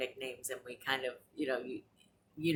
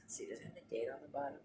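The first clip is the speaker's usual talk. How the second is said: muttered aside; 11 dB quieter, much faster than usual. Real, not acted. Checking mug bottom, aside.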